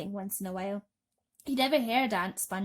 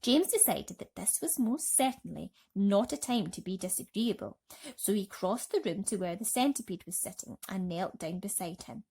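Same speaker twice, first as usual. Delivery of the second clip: usual speaking voice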